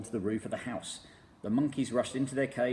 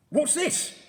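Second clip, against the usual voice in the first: high, loud shocked gasp; stunned on walking in; 9 dB louder than usual. A narrator's reading. Mister Twit entering the room, shocked. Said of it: "gasped Mister Twit".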